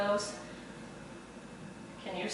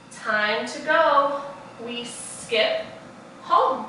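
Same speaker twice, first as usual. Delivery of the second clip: slow, deliberate storybook reading; reading to children; much slower than usual. Acted. Teacher reading a picture book to the class, slow storybook voice. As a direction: read each page slowly for little ones.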